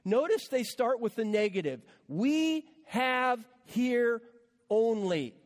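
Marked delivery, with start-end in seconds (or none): 2.1-5.3 drawn-out, very slow and sarcastic; sneering, slow disciples' reply. Shown as a demonstration. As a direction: drag out "We have here only" with scorn. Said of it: "You can almost hear the sarcasm in their voice".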